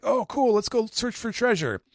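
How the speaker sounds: high, loud eager voice; eager Luffy. Retold or imitated. Luffy hearing of treasure, high eager voice. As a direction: jump at the adventure. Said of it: "Luffy's like".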